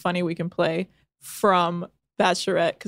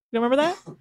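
ordinary delivery

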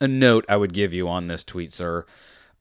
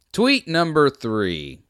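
announcer read; 6 semitones higher, 4 dB louder, slower than usual. Acted. Announcing tweets as a list.

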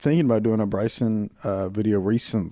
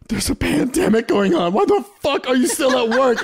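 high, raised comic alarm; mock urgent rant; 12 semitones higher, 4 dB louder than usual. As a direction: berate a telemarketer in mock panic. Acted rant at a telemarketer.

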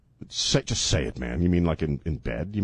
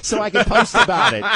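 high, giggly socialite voice; high chirpy woman voice; 7 semitones higher than usual. Acted. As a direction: brag brightly in a high voice. Acting the fiancée.

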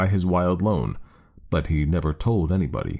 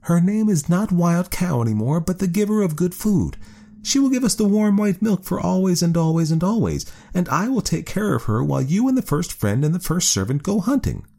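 much higher, bright proud wife; high woman's voice, pleased; 12 semitones higher than usual. A narrator's reading. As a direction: raise the pitch high as the woman. Woman character voice.

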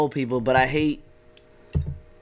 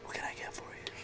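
barely audible whisper; hushed, voiceless bartender whisper; 22 dB quieter than usual. Acted. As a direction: whisper the order question very softly. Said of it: "bartending would not work whispering".

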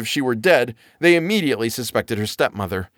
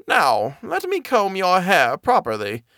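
put-on sly old woman; 8 semitones higher than usual.

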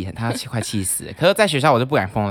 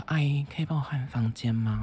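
well-behaved student act; 7 dB quieter than usual. Retold or imitated. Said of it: "我现在就是要装那种乖学生样子".

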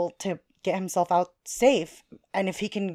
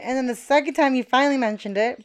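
usual speaking voice

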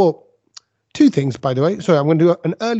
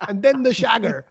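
higher mock Italian, "sugar" as "shagger"; bad Italian accent; 5 semitones higher than usual. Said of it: "sugar is what he was getting at".

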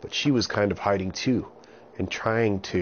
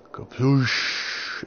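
splash sound; 6 semitones higher than usual. A narrator's reading. Splash sound effect in story.

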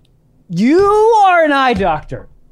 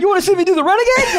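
mock politician; 10 semitones higher, much faster than usual.

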